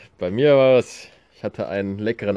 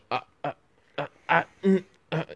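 imitated stuck stammer in short, higher bursts; nervous stammer; 8 semitones higher, faster than usual. Showing how Allergietest comes out when nervous, stammering. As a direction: get stuck on the first vowel, mock tongue-tied. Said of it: "Dann hört sich das ungefähr so an".

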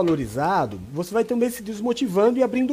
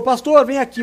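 louder call across the church, higher; an elder calls the pastor over, louder and higher; 5 semitones higher, 7 dB louder than usual. Retold or imitated.

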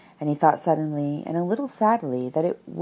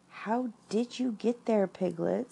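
usual speaking voice

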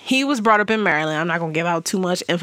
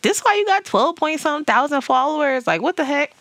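surprised disbelief; 8 semitones higher than usual. Retold or imitated. Retold surprise at follower count.

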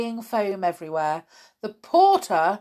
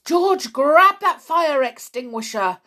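urgent raised-voice command; shouted order; 6 semitones higher, 4 dB louder than usual. A narrator's reading. Porter ordering George, shouted. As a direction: call out the order sharply. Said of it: "shouted the porter".

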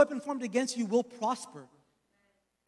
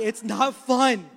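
loud emphatic; 8 dB louder, much slower than usual. Real, not acted. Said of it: "repeated loud then soft".

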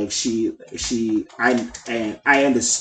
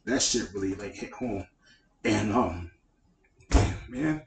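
choked up; 2 semitones lower, 7 dB quieter than usual. Real, not acted. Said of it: "Let it out, big dog".

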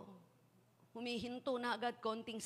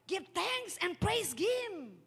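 fervent preaching; 8 semitones higher, 5 dB louder, much slower than usual. Real, not acted.

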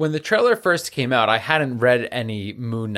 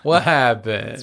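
slow, stretched-out teasing question; milk the question slowly for effect; mock suspense, dragged out; drawn-out mock dramatic; slower than usual. Co-host repeats, drawn out.

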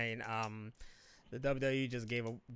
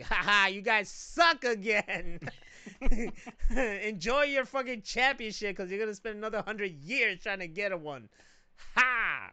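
loud, high, jeering taunt with laughter; taunting gloat; 7 semitones higher, 8 dB louder than usual. Retold or imitated. Taunting a Cubs fan coworker, loud gloating jeer. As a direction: rub it in his face, cackling. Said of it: "You said it just like that?".

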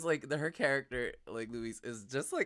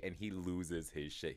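ordinary talk

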